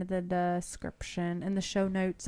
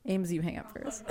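ordinary delivery

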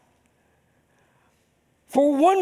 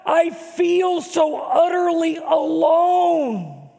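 slow anguished shout, higher and louder; crying out; 6 semitones higher, 3 dB louder, slower than usual. Acted. Jesus on the cross crying out to God, slow anguished shout. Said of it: "He called out, in effect".

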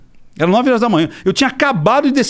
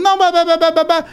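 higher, dismissive "bah, bah" babble; mock blather; 9 semitones higher than usual. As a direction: parody his nonstop talking as empty noise. Mocking the other man's blather.

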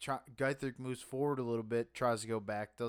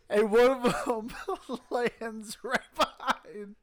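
laughing; 9 semitones higher, 6 dB louder, slower than usual. Real, not acted. Laughing while telling the story.

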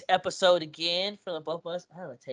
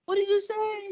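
high, prying ask; therapist's high question. Retold or imitated. Therapist asks what he said, in a high voice. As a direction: imitate her asking sharply.

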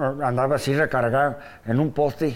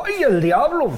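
mock fright; 9 semitones higher, 3 dB louder, slower than usual. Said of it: "Donde la miro, digo".

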